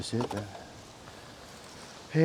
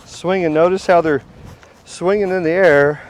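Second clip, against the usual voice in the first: louder and higher, thrilled by the swarm; excited; 3 semitones higher, 7 dB louder than usual. Real, not acted. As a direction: call it out with excitement.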